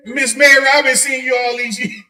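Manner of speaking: imitating a woman forcing a high voice; high, loud mimic of the gas station lady; put-on high voice. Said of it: "Change her voice".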